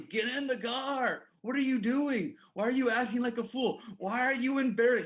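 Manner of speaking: put-on teen brother berating the kid on the ground, loud and exasperated; irritated scolding. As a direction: scold him like an annoyed big brother. Impatient brother scolds, retold.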